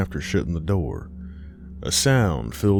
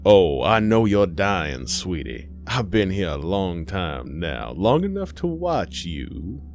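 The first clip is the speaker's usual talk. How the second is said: menacing sweet spirit. Acted. Spirit tells dying Aisha it watched her, creepily sweet. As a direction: croon a creepy threat with false sweetness.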